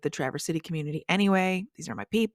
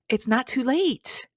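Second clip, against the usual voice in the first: happy realization; 7 semitones higher, 4 dB louder, slower than usual. Acted. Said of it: "It's that feeling of".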